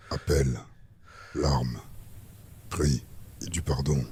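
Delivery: ordinary delivery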